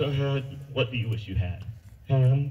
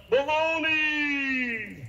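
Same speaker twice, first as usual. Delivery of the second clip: high, long-drawn falling call; yelled out silly; 11 semitones higher, much slower than usual. Acted. Man tests echo with something silly, drawn-out yell. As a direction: holler the word across a canyon. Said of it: "And so he yelled out".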